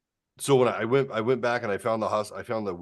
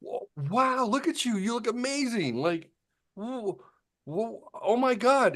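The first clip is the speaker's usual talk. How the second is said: higher-pitched retold gasp of amazement, lightly acted; amazed nurse; 4 dB louder than usual. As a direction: imitate the nurse's wide-eyed amazement at his change. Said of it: "She's like".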